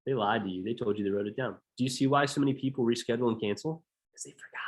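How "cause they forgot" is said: hushed aside; breath-only whisper, no voice. Real, not acted. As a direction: drop to a whisper to let it land. Aside to the audience.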